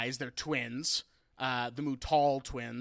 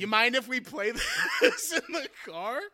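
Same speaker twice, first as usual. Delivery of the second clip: laughing through the quote; 8 semitones higher, 3 dB louder than usual. Retold or imitated. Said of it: "And he's like".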